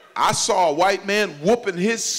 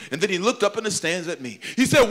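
ordinary talk

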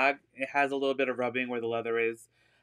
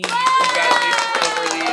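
excited cheer; 10 dB louder than usual. Real, not acted. Announcing a winner of an item.

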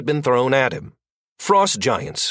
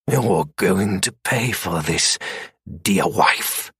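low seething threat; 5 semitones lower than usual. A narrator's reading. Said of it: "wrath built a fire".

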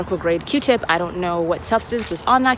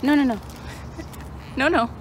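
high playful scolding; 7 semitones higher than usual. Real, not acted. Dog misbehaving.

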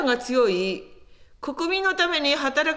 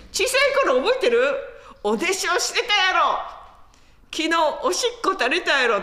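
higher and louder, mock teasing Kansai neighbour; teasing hometown neighbour; 5 semitones higher, 3 dB louder than usual. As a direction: tease him about his childhood in Kansai dialect. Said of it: "とか言ってですね". (関西弁で高く大きくからかう近所の人)

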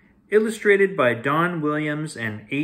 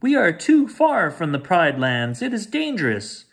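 high, louder, fretful bird warning; fussy warning; 6 semitones higher, 5 dB louder than usual. Acted. The king's minister warns the cubs, high and fretful. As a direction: squawk out a nervous warning. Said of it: "he warned".

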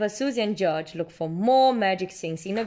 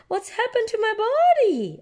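high, louder astonished cry; surprised squeal; 4 dB louder than usual. A narrator's reading. Peppa at the funny mirror, high astonished cry. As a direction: gasp it out in shock. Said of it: "asks Peppa, giggling".

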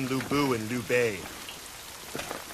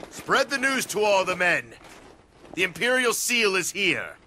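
triumphant cry to the troops; rousing proclamation; 8 semitones higher than usual. Acted. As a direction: announce it to every soldier in earshot. Lord announces seal to troops.

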